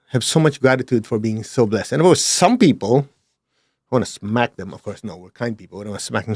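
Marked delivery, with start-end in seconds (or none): none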